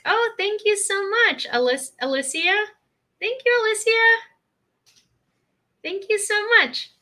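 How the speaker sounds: excited high thank you; gushing thanks. Real, not acted. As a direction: pour out thanks to the donors. Thanking donors on livestream.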